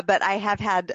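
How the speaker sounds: ordinary delivery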